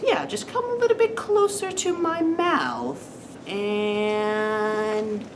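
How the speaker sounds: high, slow falsetto granny luring someone in; slow, high-pitched sly disguise; wolf as grandma. Acted. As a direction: draw it out high as a fake grandma. Disguised wolf lures the girl.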